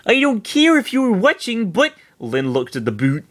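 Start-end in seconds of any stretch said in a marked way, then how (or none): 0.1-1.9 put-on high girl voice. Lynn voiced in read fiction.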